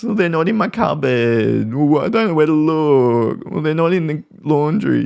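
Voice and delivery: put-on high, whining child voice; whiny victim child. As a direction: imitate a sulky kid blaming everything. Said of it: "This whinging, complaining".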